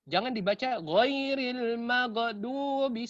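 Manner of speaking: ghairil maghdubi with a bouncing g in maghdubi; wrong qalqalah. Shown as a demonstration. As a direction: stretch maghdubi and echo the gh. (bacaan salah, qalqalah pada maghdubi)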